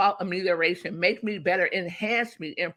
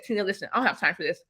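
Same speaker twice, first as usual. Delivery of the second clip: curt mentor, fast; much faster than usual. Retold or imitated. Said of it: "you said".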